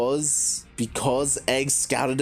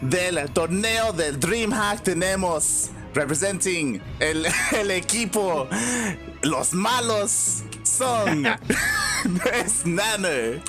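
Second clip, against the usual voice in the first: high, hyped ring announcer with laughs; lucha libre announcer; 7 semitones higher than usual. Pretend. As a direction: hype the fighters like wrestling night. Mock Spanish ring intro.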